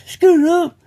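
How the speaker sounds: very high, loud whooped signal; shrill sustained call for help; gang distress call. Retold or imitated. Imitating gang member's call for backup, loud high whoop. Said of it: "his, his was".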